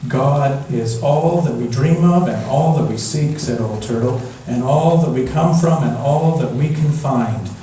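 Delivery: plain reading voice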